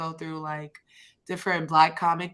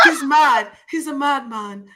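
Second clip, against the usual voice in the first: higher, laughing British accent; mock British accent; 7 semitones higher, 3 dB louder than usual. Shown as a demonstration. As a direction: put on a bad British accent through laughter. More British accent demo, laughing.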